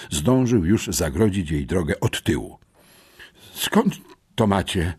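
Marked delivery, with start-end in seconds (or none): 3.5-4.9 choked, faltering question. A narrator's reading. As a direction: force the question out in shock. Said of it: "wykrztusiła".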